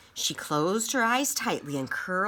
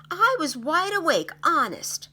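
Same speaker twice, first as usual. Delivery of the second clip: insisting; 11 semitones higher, 6 dB louder than usual. Acted. Insisting against doubt.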